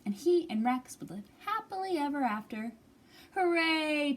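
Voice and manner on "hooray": bright loud hooray; happy cheer. Acted. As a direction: cheer it with joy. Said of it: "cheered Bob".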